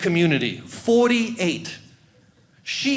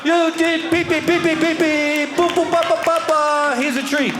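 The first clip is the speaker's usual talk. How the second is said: much higher, loud sing-song cheering; pee-pee dance cheer; 8 semitones higher, 3 dB louder than usual. Retold or imitated. Praising the dog for peeing, giddy sing-song cheer. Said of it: "I'm like".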